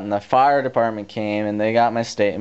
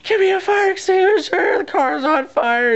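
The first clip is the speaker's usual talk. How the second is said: retold desperate shout. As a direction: scream it like nobody listens. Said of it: "I'm like".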